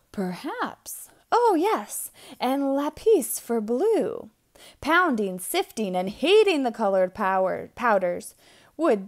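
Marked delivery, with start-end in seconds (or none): 0.1-4.3 light up at the discovery; storyteller delight; eureka. A narrator's reading. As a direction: gasp then higher, excited. Discovering answer.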